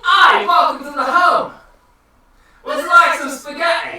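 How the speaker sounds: loud, high-pitched Italian caricature; mock Italian accent. Player asked for Italian, loud mock Italian accent. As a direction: exuberant bad Italian accent. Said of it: "Make him Italian".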